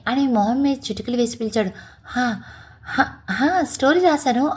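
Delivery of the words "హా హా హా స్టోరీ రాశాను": flustered, tripping over the words; stammering. Acted. Wife stammers, startled by her husband's snapping fingers. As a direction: caught off guard, stumbling through the answer. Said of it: "తడబడుతూ అంది".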